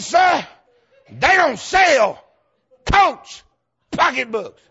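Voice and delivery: high, loud, slow shouted "they don't sell Coach pocketbooks"; clerk's line bellowed as a punchline; shouted retelling. Retold or imitated. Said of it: "She said".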